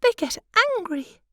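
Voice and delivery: high, light wife's voice; high pitched fussy little wife; butterfly wife. Acted. Wife confides to queen.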